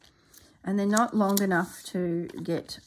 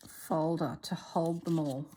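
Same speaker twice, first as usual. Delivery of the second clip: usual speaking voice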